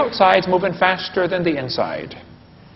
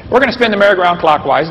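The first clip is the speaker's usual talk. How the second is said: ordinary talk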